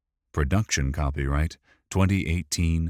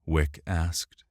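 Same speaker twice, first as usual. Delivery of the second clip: ordinary delivery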